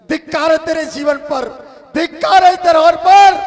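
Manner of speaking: rising from firm to a high loud shout; building rebuke. Acted. Voice condemns Bahubali for greed of land, building to a shout. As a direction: build the scorn until it bursts.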